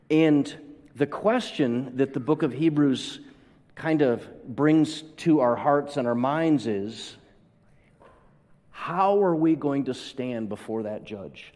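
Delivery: ordinary delivery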